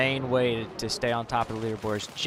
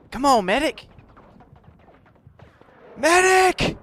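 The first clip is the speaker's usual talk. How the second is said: high, loud call for a medic; man down shout; 13 dB louder, slower than usual. Real, not acted. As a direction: yell for help while down. Downed, calling for medic.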